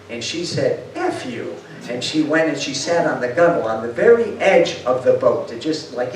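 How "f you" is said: defiant retort. Retold or imitated. Defiant woman refuses special treatment.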